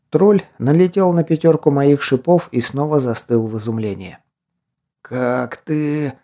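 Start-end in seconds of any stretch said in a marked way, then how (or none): 5.0-6.1 hoarse stunned gasp; wheezing rasp. A narrator's reading. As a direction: rasp it out in shock. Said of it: "прохрипел он".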